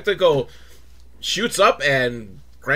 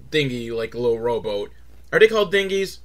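ordinary talk, nothing marked

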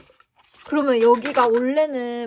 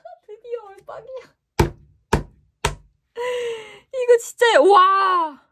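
high, rising cry with a teary break; finally got it, overcome; overjoyed cry; 10 semitones higher, 7 dB louder than usual. Real, not acted. Fan finally getting a close-up, crying out with joy.

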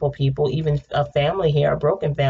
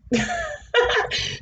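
high squealing laugh. Real, not acted. Reacting to the reading.

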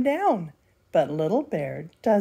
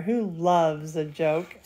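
ordinary delivery, nothing marked

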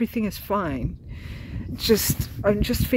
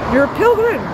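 much higher and louder, awed "You're a pilgrim"; amazed exclamation; 13 semitones higher, 8 dB louder than usual. Retold or imitated. Old man realising she is a pilgrim, amazed high exclamation. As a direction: blurt it out in sudden wonder.

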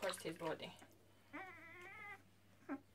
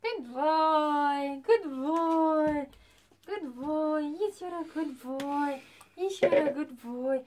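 high, loud, sing-song praise; praising a dog; 4 semitones higher, 6 dB louder, slower than usual. Real, not acted. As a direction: cheer the puppy on brightly. Praising puppy loudly.

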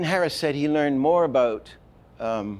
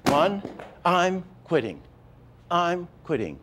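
each word punched higher; three accents; 6 semitones higher than usual. Shown as a demonstration. Animation teacher demonstrating three hard accents. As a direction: hit one, I'm and quitting hard. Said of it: "three accents".

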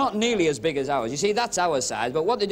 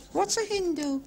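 squeaky mock questioner; high-pitched daft question; high silly voice; 10 semitones higher, 4 dB quieter than usual.

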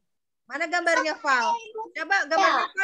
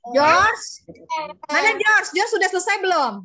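raised-voice teacher calling a pupil; 2 semitones higher, 4 dB louder than usual. Real, not acted. Teacher checking if George has finished, calling out loudly.

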